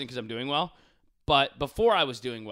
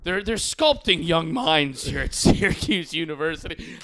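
mock posh, louder sarcasm; sarcastic; 4 semitones higher, 8 dB louder, slower than usual. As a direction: sneer at the university. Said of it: "And then I'm like".

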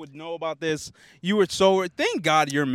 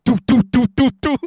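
imitated knocking; 7 semitones higher, 9 dB louder than usual.

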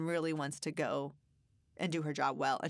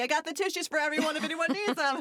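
high, louder sing-song offer to the room; higher, louder mock-cheery hostess call; cheery announcement; 10 semitones higher, 5 dB louder than usual. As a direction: announce the tissues brightly to everyone. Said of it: "real Patty came out and was like".

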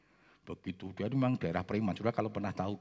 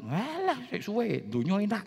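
friend brushing off; 11 semitones higher, 3 dB louder than usual. Retold or imitated.

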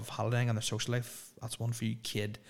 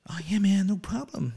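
high mock American agreeing cheerily; hammed-up eager yes; bad American accent; 8 semitones higher, 4 dB louder than usual. Performer agreeing again, in a high bad American accent. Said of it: "really bad American accents".

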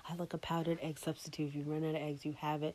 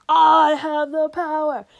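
booming much louder and higher, slowed; mock grand boast; 11 semitones higher, 19 dB louder, slower than usual. Bragging about her power to make him laugh, hammed-up booming. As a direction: proclaim it like a comic-book villain.

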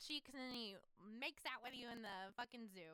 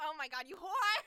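loud, high playful exclamation; mock outrage; 5 semitones higher, 15 dB louder than usual. Real, not acted. Friend teasing the other over the guy, mock outraged.

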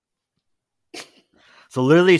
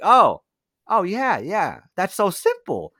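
mock relief; 6 semitones higher than usual. Friend feigns relief to owner.

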